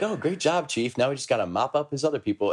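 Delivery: a hint of a cheerful AI guide; mildly imitated chipper praise; lightly voiced Cortana. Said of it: "And she'd be like".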